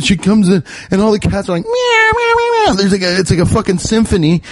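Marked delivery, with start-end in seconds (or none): 1.6-2.7 high mock cat meowing; squeaky imitated meows. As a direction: meow like a crowd of happy cats. Cats imitated greeting the lady.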